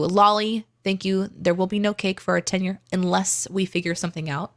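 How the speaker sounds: normal talking voice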